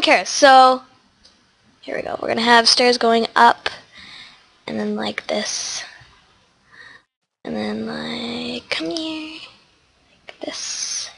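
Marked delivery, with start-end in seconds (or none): 4.7-11.1 soft whispered murmur while working. Real, not acted. Building the entrance stairs, whispering to herself. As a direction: breathe it out under your breath.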